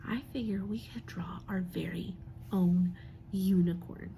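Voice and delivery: soft conspiratorial whisper. Real, not acted. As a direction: let them in on a little secret. Aside to the class.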